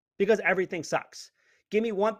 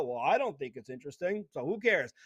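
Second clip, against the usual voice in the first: lower, lightly voiced mock of a bored panelist; a hint of a dismissive expert brushing it off; 5 semitones lower than usual. Mimicking the panelist who dismissed the question, low and bored. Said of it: "you just dismiss and go".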